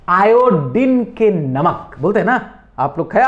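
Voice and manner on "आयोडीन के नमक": Iodine drawn out very slowly; slow emphasis. Shown as a demonstration. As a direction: stretch iodine for the class. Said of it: "teacher stressing key word".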